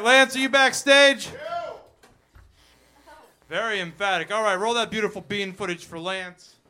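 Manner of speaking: normal talking voice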